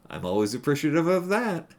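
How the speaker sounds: ordinary talk, nothing marked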